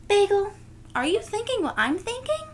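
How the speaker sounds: high, loud and quick, eager; a girl bursting with an idea. Acted. Nellie asking her dog Bagel, high and eager.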